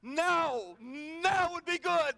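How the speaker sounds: loud, high, slow now; urgent mock plea. Man in a skidding car, urgent loud plea. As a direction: press God for timing. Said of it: "Remember using the word now".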